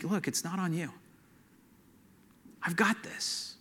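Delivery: softly voiced comfort, unhurried pauses between phrases; gentle reassurance. Holy Spirit comes alongside a worried man, soft gentle reassurance. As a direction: drop to a soft, tender reassurance.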